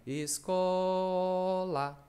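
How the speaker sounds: "Escola" drawn out, stressing "co"; teacher spells out "Escola" slowly for the class; slow syllables. Shown as a demonstration. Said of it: "Tá aqui, ó".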